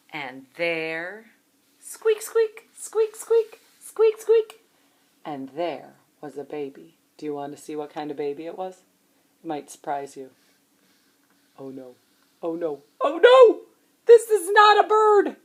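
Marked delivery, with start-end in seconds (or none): 1.8-4.6 tiny squeaks. Acted. Imitating baby hatching from egg. 11.6-15.4 mock alarm. Reader reacts to alligator hatching.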